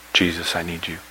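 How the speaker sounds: low, soft cry to Jesus; a quiet, understated prayer of need; hushed plea. Acted. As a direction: say it softly and low, as a private plea. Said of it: "the cry of a lost soul".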